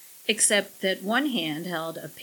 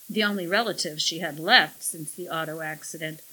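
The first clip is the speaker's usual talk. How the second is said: plain reading voice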